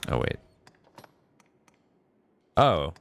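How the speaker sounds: usual speaking voice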